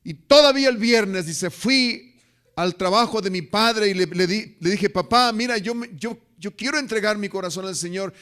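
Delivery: loud, high fervent preaching; preaching at full force. Acted. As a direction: drive the story home to the pews. (predicación ferviente y fuerte)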